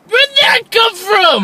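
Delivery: high, slow cry of disbelief; baffled outburst. Pretend. Reviewer reacting to a nonsensical plot twist, incredulous outburst. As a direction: exclaim it in exaggerated bafflement.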